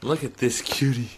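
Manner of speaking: ordinary talk, nothing marked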